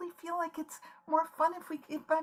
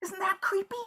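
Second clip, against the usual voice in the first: ordinary delivery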